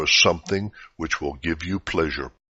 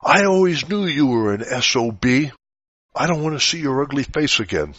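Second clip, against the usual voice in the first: raised, higher mock yell of rejection; hammed-up furious yell at a leaving spouse; angry yell; 8 semitones higher, 4 dB louder than usual. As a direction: read it as a hurt partner yelling, pitch up and louder. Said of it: "he or she will yell".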